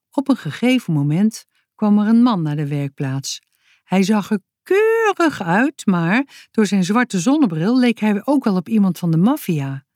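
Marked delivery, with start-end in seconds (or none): none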